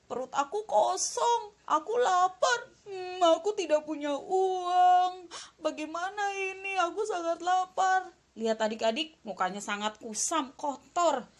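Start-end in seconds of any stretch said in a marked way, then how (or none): 0.1-8.1 higher, louder whining about hunger; mock tearful moan; hungry whimper.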